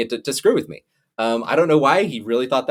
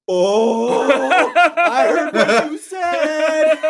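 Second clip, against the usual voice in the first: high, slow, teasing dad voice; smug dad who caught his son swearing; gloating dad; 5 dB louder, much slower than usual. Retold or imitated. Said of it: "he goes".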